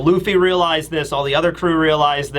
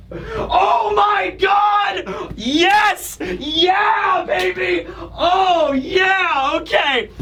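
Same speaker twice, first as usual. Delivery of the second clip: much higher cheering shouts, drawn out; real excitement; 14 semitones higher, much slower than usual. Real, not acted. Fan celebrating Kuma nuking Saturn, ecstatic cheers. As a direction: cheer like your team just scored.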